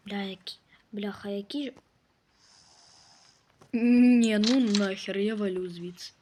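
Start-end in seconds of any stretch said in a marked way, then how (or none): 3.7-6.1 loud startled outburst; loud bail-out. Real, not acted. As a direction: yell that you're getting out. Scared off by game event. (гучно, налякано)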